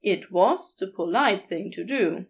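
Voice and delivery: usual speaking voice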